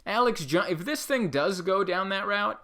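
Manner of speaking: ordinary talk, nothing marked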